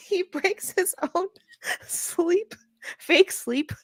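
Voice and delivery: high giggly voice, laughing through. Real, not acted. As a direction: squeal it out while laughing. Laughing at fake sleep.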